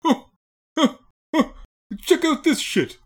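high, loud Dangerfield leer; Rodney Dangerfield. Retold or imitated. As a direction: chuckle then leer like Dangerfield. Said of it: "Rodney Dangerfield... just sort of being like".

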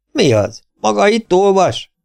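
high, loud sharp rebuke; snapping at him. A narrator's reading. Sheriff snaps at Holborn reading the paper, high sharp rebuke. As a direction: snap at the reader. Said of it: "förmet rá a sheriff".

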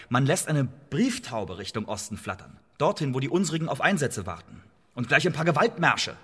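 normal talking voice

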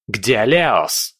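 sharp angry bark; barked demand. Acted. Prince barks at the young man, demanding. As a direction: snap the question like an order. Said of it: "рявкнул князь".

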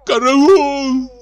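high, loud, drawn-out scream; terrified cry for help. A narrator's reading. Drunk comic screams for help in terror. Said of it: "закричал он".

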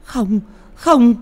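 high, loud anguished scream; panicked mother's cry. Acted. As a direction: plead at full pitch, stretching the last word. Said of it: "bà Cả la lên".

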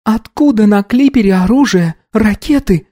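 high female voice, somewhat grim; gloomy query. A narrator's reading. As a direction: question it darkly in a high voice. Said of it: "мрачно уточнила молчавшая".

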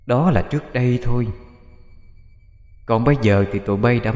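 a hint of a soft, menacing ghost; lightly voiced demon. A narrator's reading. The demon tells Bích he dies tonight, soft menace. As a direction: threaten quietly, savouring the kill.

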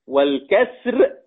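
ordinary talk

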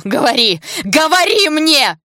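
high, very loud, drawn-out cry; screaming demand. A narrator's reading. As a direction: scream at her to talk, stretching the last words. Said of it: "закричала во всю мощь лёгких".

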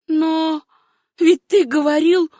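put-on high, imploring girl's voice; hurt young woman. A narrator's reading. Jilted girl reminds her lover, high hurt appeal. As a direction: appeal to him high and disbelieving.

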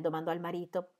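ordinary delivery, nothing marked

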